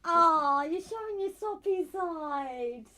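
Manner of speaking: high, slow teasing coo. Real, not acted. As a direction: coo at him mockingly. Teasing him about soft side.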